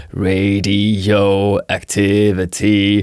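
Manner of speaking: very slow, drawn-out syllables. Shown as a demonstration. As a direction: stretch each syllable like a robot. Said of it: "He would say something like".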